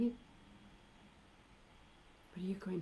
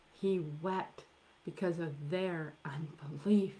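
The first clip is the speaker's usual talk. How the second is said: "unbelief" whispered, breath only; slower than usual. Shown as a demonstration. Preacher repeating why Jesus wept to the congregation, whispered. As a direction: drop the repeat to a hushed whisper.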